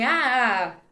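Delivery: a shout ringing back in an empty room; canyon echo. Real, not acted. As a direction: call out and let it echo. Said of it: "Se vocês estão ouvindo um eco".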